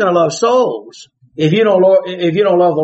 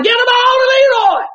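fans hollering. Retold or imitated. Fans yelling at the coach again. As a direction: shout it from the stands. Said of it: "they just kept hollering".

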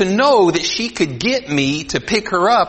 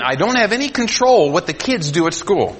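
usual speaking voice

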